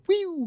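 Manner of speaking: high, loud whistling whoosh; movie bullet whistle. Retold or imitated. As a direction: mimic a Hollywood bullet flying past. Imitating movie bullet sound.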